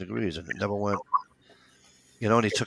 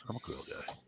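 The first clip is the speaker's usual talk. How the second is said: whispering the repeat to himself; whispered aside; 19 dB quieter than usual. Real, not acted. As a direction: drop to a breathy whisper. Repeats his line, then whispers it.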